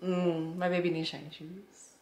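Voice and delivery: normal talking voice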